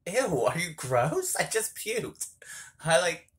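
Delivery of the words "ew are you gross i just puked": higher, slow disgusted ew. Retold or imitated. Pushing away the guy trying to kiss her, disgusted. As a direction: recoil in mock disgust. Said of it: "I'm like".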